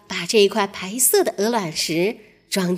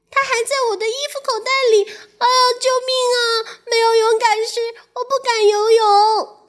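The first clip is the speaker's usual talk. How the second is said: high, fast panicked cries for help; 13 semitones higher, 3 dB louder, faster than usual. Acted. Mouse struggling in the pond cries for help, high fast panic. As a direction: rush it out in panic.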